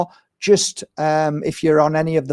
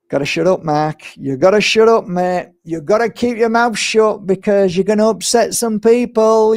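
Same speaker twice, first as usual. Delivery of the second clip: higher, louder mock warning to himself; mock self-scolding; 7 semitones higher, 4 dB louder than usual. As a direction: tell yourself off, half joking. Scolding himself to stay quiet.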